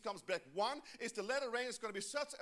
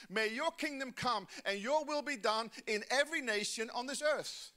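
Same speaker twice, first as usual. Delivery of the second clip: loud, ringing preacher's proclamation; preaching at full force; 5 dB louder than usual. Acted. Pastor proclaiming the Great Commission to the church, loud. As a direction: proclaim it to the whole room.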